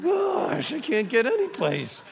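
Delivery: high, exasperated cry of frustration; frustrated outburst. Retold or imitated. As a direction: throw up your hands and cry out. Frustrated pastor's inner outburst.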